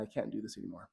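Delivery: quiet, defeated. Acted. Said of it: "so distraught and discouraged".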